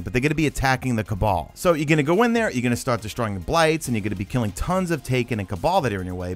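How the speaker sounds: usual speaking voice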